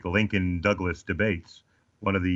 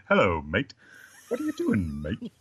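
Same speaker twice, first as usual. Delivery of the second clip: hammed-up Australian accent, pitch leaping mid-phrase; American's mock Australian accent; attempted Australian accent. Shown as a demonstration. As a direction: put on a swooping Australian "mate" greeting. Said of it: "Australian accent".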